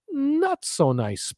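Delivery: drawn-out, soft ironic aside; dry, lifted "not so nice"; wry understatement. Real, not acted. Ironic understatement about Nassau.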